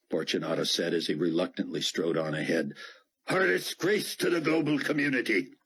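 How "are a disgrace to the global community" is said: a hint of a haughty official, higher; stiff, offended officer barking a threat; lightly voiced indignant commander. Fortunato to Dart, lightly voiced indignant threat.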